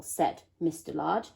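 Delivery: usual speaking voice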